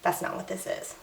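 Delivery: hushed, near-whispered inner voice; soft private denial, barely voiced. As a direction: say it under your breath, to yourself. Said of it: "in my head, I was just like".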